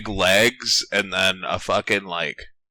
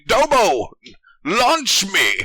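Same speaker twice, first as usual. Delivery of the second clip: hammed-up battle cry; 12 semitones higher, 4 dB louder, slower than usual. Master Asia calls to Domon.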